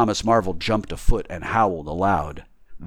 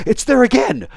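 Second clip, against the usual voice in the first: high, loud dismay; rattled tramp's raised voice; alarmed tramp; 9 dB louder than usual. Acted. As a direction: cry out as if haunted.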